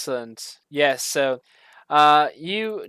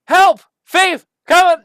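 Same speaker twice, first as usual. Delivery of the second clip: alarm cries; 13 semitones higher, 10 dB louder than usual. Acted. GM replays the alarm.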